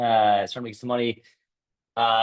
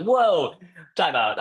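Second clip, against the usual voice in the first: high, louder mock-shocked whoa; alarmed protest; 8 semitones higher, 3 dB louder, much slower than usual. Husband reacting to wife joining the pricey program, mock alarm. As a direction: call a halt in retold alarm.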